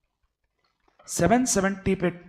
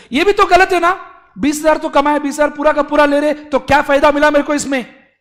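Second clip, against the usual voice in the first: much higher, louder whining; mock crying complaint; 6 semitones higher, 8 dB louder, faster than usual. Taxpayer Mister A wailing at the government, mock tearful. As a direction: wail that nothing was gained, hammed up. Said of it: "completely crying".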